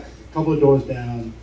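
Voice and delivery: normal talking voice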